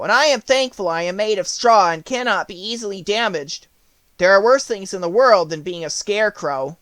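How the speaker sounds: lively Scarecrow voice; Scarecrow's voice. A narrator's reading. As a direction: play the Scarecrow, eager and light.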